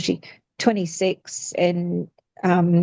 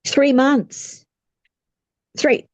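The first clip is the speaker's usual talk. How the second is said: shocked disbelief; 7 semitones higher, 3 dB louder than usual. Real, not acted. Shocked at a short timeframe.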